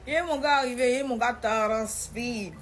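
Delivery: storyteller raising the tension; dramatic build. Real, not acted. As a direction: tell it as a big reveal. Dramatic arrival of the boyfriend.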